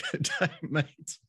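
slow, hammed-up Australian accent echoing the guest. Host echoes guest's greeting in a mock Australian accent. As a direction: copy the Aussie greeting lightly. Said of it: "if you have an accent".

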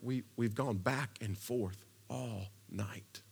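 slow, hushed whisper between fishermen. Acted. Tired fishermen whisper to each other in the boat. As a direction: confide it under your breath, slowly. Fishermen mutter among themselves.

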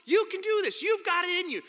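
high, loud pep talk; hearty encouragement. Retold or imitated. Counselor pumping up the scared kid, high and loud. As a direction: pump him up.

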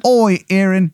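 high-pitched, hammed-up English accent; Henry the eighth, bad English accent. King greets Aaron, in a bad English accent. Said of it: "it's a very bad English accent".

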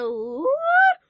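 very high, loud shocked squeal; stunned high-pitched cry; shocked what. Retold or imitated. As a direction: squeal it in total disbelief. Reliving shock hearing God.

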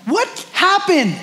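high, drawn-out incredulous question; slow, high-pitched disbelief, played for laughs; mock bafflement. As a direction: act stunned, pitch leaping up. Baffled by parent's sudden change.

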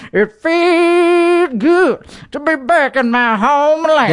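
high, slow put-on voice; trademark voice. Host gushing about Texas in a high silly voice. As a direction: stretch it out in a silly sung voice. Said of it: "stop stealing my trademark voice".

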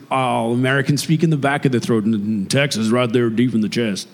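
deep Texan American, down in the chest; American accent. Shown as a demonstration. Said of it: "You also can do an American accent".

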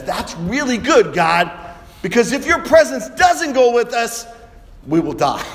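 impassioned acted protest; urgent insistence. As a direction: press the point forcefully, then drop in pitch. Moses urgently answers God.

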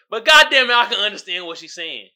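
usual speaking voice

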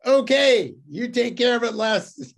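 loud, high mock of relieved relatives; grudging handoff. Relatives happily handing the cost to Les, loud and high. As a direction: toss the bill off onto him, half-laughing. Family dumps the cost on him.

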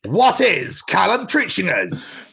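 loud, high, drawn-out show announcer; segment announcement. Pretend. Announcing the podcast's segment to listeners, big and drawn out. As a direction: stretch it out big, slowly.

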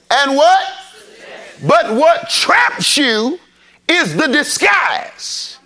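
slow, emphatic preacher's build; preaching. Acted. Preacher pressing the congregation, emphatic and slow. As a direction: press each phrase on the congregation.

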